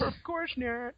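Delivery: high goofy put-on voice; silly voice. Host joining co-host's joke, high silly mock voice. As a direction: play along in a silly high voice.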